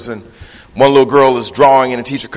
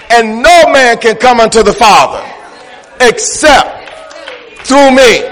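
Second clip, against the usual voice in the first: high, forceful sermon delivery; full-force preaching; 10 semitones higher, 5 dB louder than usual. Acted. Preacher rousing the church against watered-down gospel, full-force preaching. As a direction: drive each declaration home to the congregation.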